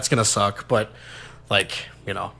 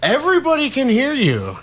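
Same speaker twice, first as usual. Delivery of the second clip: high, loud, laughing announcement; raised, amused voice, pitched way up; loud teasing call-out; 5 dB louder than usual. Real, not acted. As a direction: call her out loudly through a laugh. Calls out the friend's whispering.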